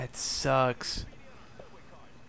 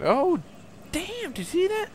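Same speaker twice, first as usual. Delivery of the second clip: surprised squeal. Real, not acted. Shocked at game event.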